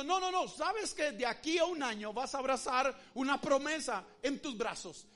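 loud, emphatic promise of God; booming divine promise. Acted. God answering the doubting believer, loud and emphatic. God's reply voiced, louder.

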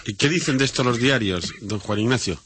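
normal talking voice

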